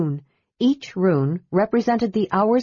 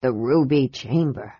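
low, hushed wonder; awed; 6 semitones lower than usual. A narrator's reading. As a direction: say it reverently. Flint awed.

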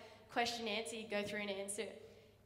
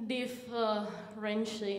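slow, weary teacher drawl; lazy sighing teacher; 2 semitones lower, 5 dB louder, much slower than usual. Retold or imitated. Lazy maths teacher announcing topic, slow and weary. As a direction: drag the word out like a tired man.

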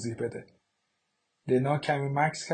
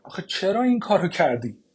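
louder and higher, pressing "این" hard; anguished outcry; 5 semitones higher, 7 dB louder than usual. Acted. Mother cries out at her daughter for burning the letter. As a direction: burst out with the question she just asked.

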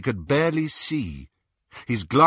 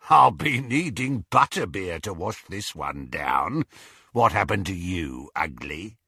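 gloating werewolf. Acted. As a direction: savour every word like prey. Greyback taunts Harry.

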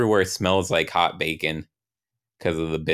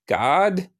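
high, rising call for attention; questioning; 12 semitones higher than usual. Acted. Young Ryan steps up to God, high questioning voice. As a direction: ask it upward, much higher.

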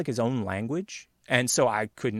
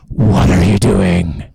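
low, explosive mock roar; in-your-face yell; 5 semitones lower, 16 dB louder, much slower than usual. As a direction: bellow it slowly, imitating an angry coworker. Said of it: "in my face".

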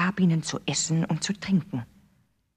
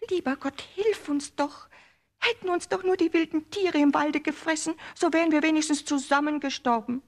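put-on higher child voice, lamenting to God; wailing little girl; 12 semitones higher, 4 dB louder than usual. A narrator's reading. Gretel praying while carrying water, higher wailing girl voice. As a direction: plead to heaven, in despair. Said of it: "wie jammerte die arme Gretel".

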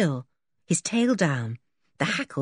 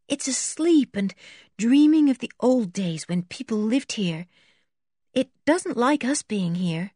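musing to herself; 5 semitones higher than usual. A narrator's reading. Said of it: "half to herself".

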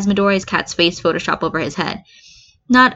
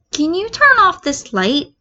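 put-on much higher, slow girlish ask; Ashido voice; 11 semitones higher than usual. Ashido asks the ghost to switch off the light, high and slow. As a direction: ask the ghost sweetly and slowly. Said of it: "Ashido asks".

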